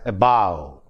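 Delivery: "a bow" as above, weak "a", long stressed "bow"; a bow, weight on the second syllable. Shown as a demonstration. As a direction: lean on "bow" in "a bow". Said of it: "Bow is stressed".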